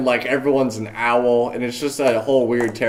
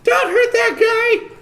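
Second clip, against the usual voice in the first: high squeaky voice. Acted. DM voices a crowd member.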